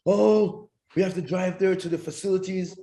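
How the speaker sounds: lightly voiced official answering; a hint of a cheerful official; mock staff voice.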